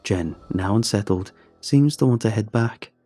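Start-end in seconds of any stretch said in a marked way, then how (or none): none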